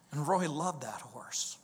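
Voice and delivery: breathy whispered aside; hushed confiding whisper. Real, not acted. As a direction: drop to a whisper, as if sharing a secret.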